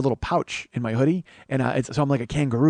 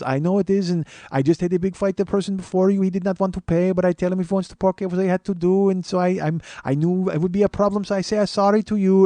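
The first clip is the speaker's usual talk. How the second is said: put-on higher-pitched Indian-accented clerk; mock Indian accent, pitched up; 5 semitones higher than usual. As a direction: plead your case in a high Indian accent. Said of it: "he's got that, uh, accent".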